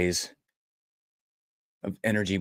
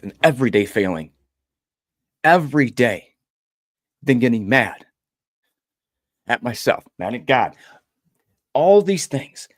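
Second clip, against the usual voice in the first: rising intensity; 3 semitones higher, 6 dB louder than usual. Real, not acted.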